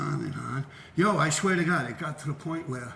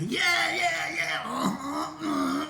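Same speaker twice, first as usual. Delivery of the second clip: mock hype; 11 semitones higher, much slower than usual. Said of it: "and he was".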